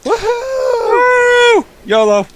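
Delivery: high, loud mock-reckless whoop; whooping daredevil cry. Player jokes about going all in, whooping high and loud. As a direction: let out a long high whoop, playing reckless. Whooping before a joke leap.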